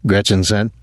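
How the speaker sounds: ordinary delivery, nothing marked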